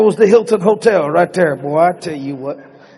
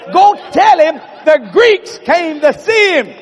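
high, slow, emphatic shout; insistent order; 7 semitones higher, 4 dB louder than usual. Acted. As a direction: spell it out loudly, word by word. John orders Peter back to Jesus.

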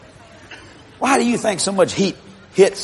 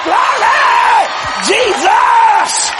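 joyful shout of praise; shouting glory; high ecstatic shout; 5 dB louder, much slower than usual. Real, not acted. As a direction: cry out to heaven. Preacher exclaims in worship.

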